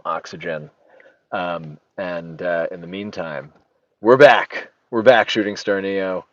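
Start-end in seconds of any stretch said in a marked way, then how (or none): 4.0-6.2 excited. Real, not acted. Host announces stream back.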